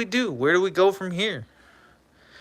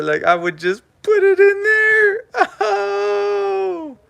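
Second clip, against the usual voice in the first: mock squeal; 14 semitones higher, slower than usual. Shoving stuff in Ben's mouth.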